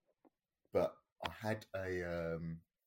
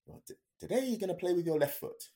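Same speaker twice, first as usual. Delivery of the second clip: mock instructor order; 13 semitones higher, 7 dB louder than usual. Instructor's order over wind.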